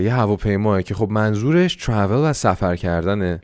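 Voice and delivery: ordinary talk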